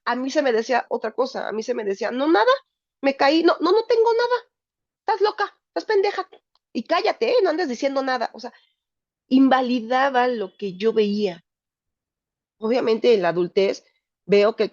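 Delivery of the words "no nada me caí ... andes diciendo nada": high, hurried denial as a scolding mother; defensive mother. Retold or imitated. Mother snapping at her daughter to hush, high and defensive. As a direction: brush the child off sharply in a high voice.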